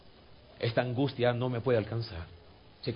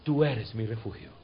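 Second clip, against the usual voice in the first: quiet, lower, gentle words to God; soft prayer; 5 semitones lower, 3 dB quieter than usual. Shown as a demonstration. Pastor modelling the prayer softly, tender. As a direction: say it tenderly, inward.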